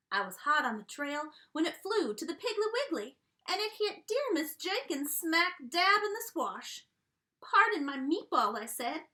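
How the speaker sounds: drawling cowboy storyteller voice; a jolly country restaurant owner spinning his yarn; folksy dog chef. Acted. Reading to kids as Yodeler Jones, folksy country voice.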